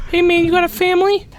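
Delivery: high fast loud squeak; high squeaky voice. Acted. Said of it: "I tell him".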